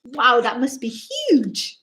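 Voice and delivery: plain reading voice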